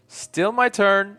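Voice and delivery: loud, high, drawn-out insistence; insistent interruption. Acted. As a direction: cut them off loudly, stretching each word. Cuts off friend's interruption of apology.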